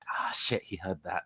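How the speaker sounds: breathless whispered wince; whispered curse. Acted. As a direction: hiss it under your breath, caught out. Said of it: "he winces in, like".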